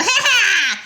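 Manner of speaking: high, loud cackling laugh; evil cackle. Acted. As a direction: cackle like a gleeful monster.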